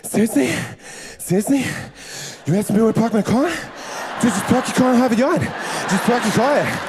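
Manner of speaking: exasperated Boston accent, laughing; annoyed Boston guy Mark Wahlberg. Shown as a demonstration. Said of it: "imagine Sofia Vergara asked you".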